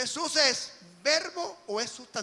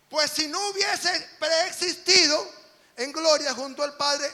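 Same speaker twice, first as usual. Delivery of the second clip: fervent preaching; 4 semitones higher, 8 dB louder than usual. Acted. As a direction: drive the point home to the congregation. Preaching at full force.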